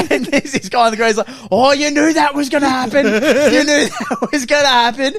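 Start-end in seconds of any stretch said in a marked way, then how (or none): 1.5-5.2 high, loud, cackling delivery; laughing taunt. Retold or imitated. Fan in the stands gloating, high and laughing. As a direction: crack up while jeering.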